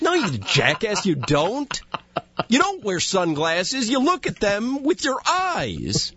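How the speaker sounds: high, incredulous comeback; high-pitched mock indignation; exasperated retort. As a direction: scold him in mock outrage, pitch jumping up.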